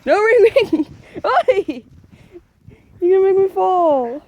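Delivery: high, loud startled scolding; alarmed scolding. Real, not acted. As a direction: scold the dog while slipping. Dog pulling her over in snow.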